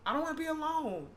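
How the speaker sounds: high, tearful quote; near tears. Real, not acted. Quoting her scared, dying grandmother, near tears. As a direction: voice lifts as crying threatens. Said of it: "I'm about to start crying".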